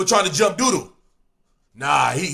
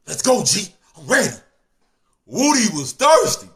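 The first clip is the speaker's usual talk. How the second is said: normal talking voice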